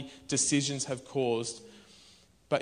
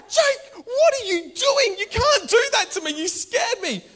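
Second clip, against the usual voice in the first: high-pitched, louder mock startled complaint; startled wife; 6 dB louder than usual. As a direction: play the wife jumping out of her skin. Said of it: "she's like".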